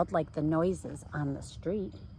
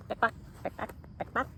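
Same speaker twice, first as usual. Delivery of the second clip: whispered clicking pick pock sounds; clip-clop clicking read to kids; breathy pick pock clicks; 5 dB quieter, faster than usual. A narrator's reading. City sounds in a read-aloud.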